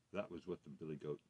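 ordinary delivery

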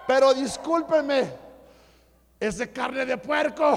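loud, high, emphatic announcement; emphatic boast. Real, not acted. Preacher defends his wife's pork pozole, loud and emphatic. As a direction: proclaim it to the whole room, slowing down.

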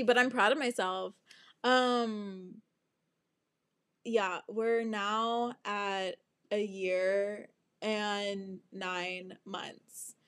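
normal talking voice

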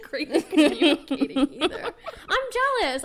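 laughing through the words. Real, not acted. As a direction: giggle while self-mocking. Laughing self-deprecation.

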